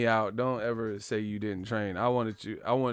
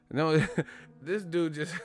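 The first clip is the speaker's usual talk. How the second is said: usual speaking voice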